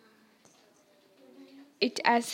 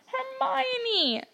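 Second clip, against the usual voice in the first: high alarmed cry of the name; horrified cry of the name; 13 semitones higher than usual. A narrator's reading. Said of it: "they said together".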